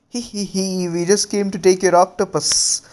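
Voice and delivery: high creepy giggle into a sly taunt; snicker, then tease him; a creepy plant creature sniggering at the Raikage; giggling Zetsu clone. Acted.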